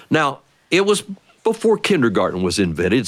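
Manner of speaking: ordinary delivery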